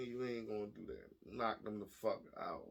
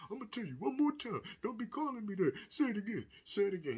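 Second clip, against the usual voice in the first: much higher, strained mock stepdad warning; 8 semitones higher than usual. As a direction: squeak out a weak threat like a nerdy dad.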